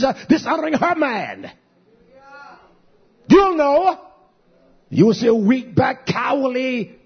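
high, loud, sustained pulpit preaching; rising sermon fervor; fiery preaching. Acted. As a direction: preach it hard to the congregation. Preaching at full force.

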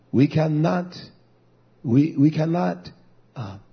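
usual speaking voice

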